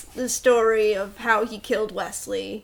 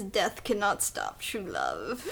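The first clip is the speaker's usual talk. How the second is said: mock solemn vow; 2 semitones lower, 7 dB quieter, slower than usual. Wesley quote retold.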